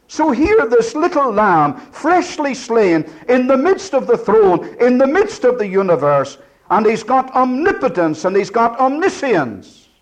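fervent pulpit proclamation; preaching at full force. Acted. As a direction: ring it out over the congregation. Preacher proclaiming the Lamb's power.

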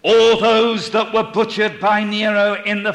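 loud, high, ringing pulpit oratory; full-force preaching. Real, not acted. Preacher proclaiming Christ's return in glory, at full force.

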